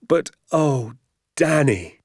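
high, delighted exclamation; joyful cry. A narrator's reading. Father to son on the road home, joyful cry. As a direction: cry out the boy's name in delight. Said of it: "he cried".

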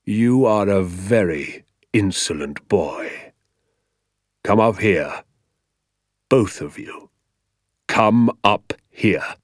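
slow, deliberate order; dangerous schoolmaster holding back anger; controlled menace. Acted. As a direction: summon them ominously, word by word. Teacher summons pupils for punishment.

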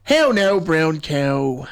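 Irish accent with narrowed vowels in "now" and "cow". Shown as a demonstration. Host showing co-host how Irish sounds, demonstrative Irish accent. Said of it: "'Cause if you're saying Irish".

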